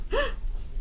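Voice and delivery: unvoiced high gasp; startled intake of breath; sharp gasp. A narrator's reading. Gasp in story.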